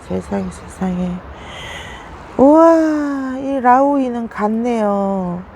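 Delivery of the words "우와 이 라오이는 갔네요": a sudden startled wow at the sight; shocked wow. Real, not acted. Discovering the dead plant in the pot, a high startled wow.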